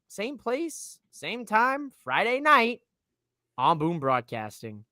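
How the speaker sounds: slow, drawn-out show sign-off; announcer sign-off. Acted. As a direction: stretch it like a host.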